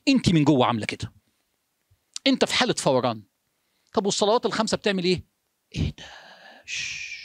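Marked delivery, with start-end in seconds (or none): 5.8-7.1 long, very soft hushing breath; soft shh. Shown as a demonstration. Preacher quieting the inner engine, a near-silent shh. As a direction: let the noise die into a hush.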